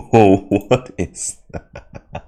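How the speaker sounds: drawn-out, slow question; slow puzzlement. Real, not acted. Puzzled by the trailer, slow drawn-out question. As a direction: ask it slowly, squinting at it.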